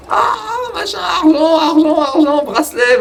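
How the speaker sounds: put-on high, shrill-pitched begging child chant; mimicking begging kids. Vlogger imitating little girls begging tourists, high pleading voice.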